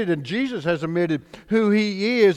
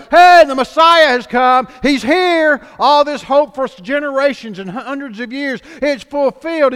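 excited shout; 6 semitones higher, 10 dB louder than usual. Acted. Imagined public announcement, shouted.

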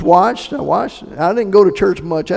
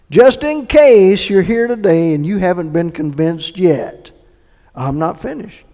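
usual speaking voice

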